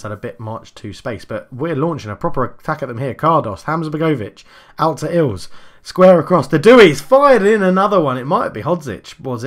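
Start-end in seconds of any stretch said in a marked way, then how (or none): 1.2-5.5 brisk running match commentary; play-by-play commentary. Acted. Live football game commentary. 5.8-8.2 loud, high goal yell; goal call. Real, not acted. Goal scored in the game.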